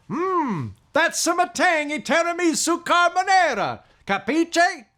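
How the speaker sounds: high, hammy Italian-American ad voice; Italian accent. Acted. Italian ad spoof.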